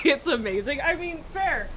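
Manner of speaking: usual speaking voice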